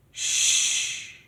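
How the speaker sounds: soft, voiceless shushing sound; imitated shush. Woman above shushing the man who yells, imitated shush. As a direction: hush someone with a quiet shh. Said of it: "saying to him".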